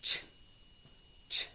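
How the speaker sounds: ch said twice as a short ch sound; phonics sound. Shown as a demonstration. Teacher modelling the ch sound for students, short. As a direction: give the ch sound twice, briefly. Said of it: "Number eight".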